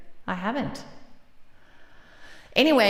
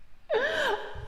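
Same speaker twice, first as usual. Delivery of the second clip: laugh. Real, not acted. Streamer laughing at Whitney's answer, giggling. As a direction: burst into a giggle.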